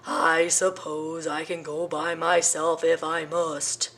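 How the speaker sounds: slow, fed-up elephant; disgusted. Acted. Elephant disgusted with his cowardly friends, slow. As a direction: sigh it with disgust. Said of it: "with a look of disgust".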